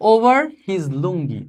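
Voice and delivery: over his lungi read slowly, word by word; slow reading aloud. Shown as a demonstration. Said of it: "এরপরে খেয়াল করো". (ধীরে ধীরে পড়ে শোনানো)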